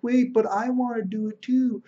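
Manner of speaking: high-pitched mock pouting whine; Josh. Excluded player asks to join the tournament, mock pouting. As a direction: imitate a left-out kid begging to join. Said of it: "he starts pouting".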